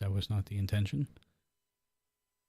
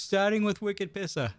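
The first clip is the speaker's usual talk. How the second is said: put-on Boston accent; 8 semitones higher than usual. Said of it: "in a Boston accent".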